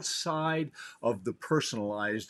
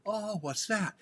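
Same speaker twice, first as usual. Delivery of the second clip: higher, lightly wondering voice; acted curiosity; 7 semitones higher, 4 dB quieter than usual. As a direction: play someone pleasantly curious on waking. Acts out curious waking people.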